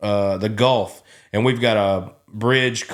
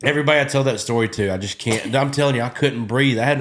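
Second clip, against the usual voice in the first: ordinary talk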